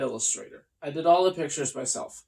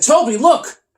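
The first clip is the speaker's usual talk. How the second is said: loud high call; excited call; 8 semitones higher, 8 dB louder than usual. Acted. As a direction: point it out urgently. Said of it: "I said".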